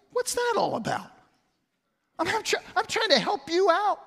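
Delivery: high-pitched mock exasperation; flustered young father. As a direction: squeak it out like a baffled new father. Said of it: "I thought, as a young father".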